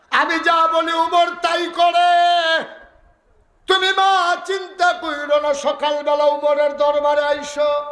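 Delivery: high, ringing preacher's delivery; full-force preaching. Acted. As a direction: cry the story out to the crowd.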